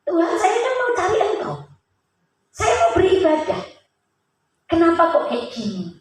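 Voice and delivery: high-pitched retold cry to God; mock-tearful prayer, starting high and easing down; imitated tearful plea. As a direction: plead like someone crying, without real sobs. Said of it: "saya nangis".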